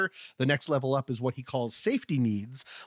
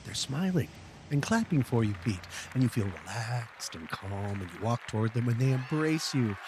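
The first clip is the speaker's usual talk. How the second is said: guided meditation; 4 semitones lower, 4 dB quieter than usual. Acted. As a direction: lull him into calm, softly. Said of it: "one of my famous guided meditations".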